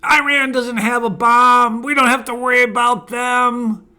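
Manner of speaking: loud, high-pitched insistence; adamant. Retold or imitated. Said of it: "he was adamant".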